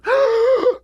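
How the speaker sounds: loud, high mock gasp; huge storybook gasp of shock; hollered gasp. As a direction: gasp loudly before hollering. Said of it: "stood up over old Coon and hollered".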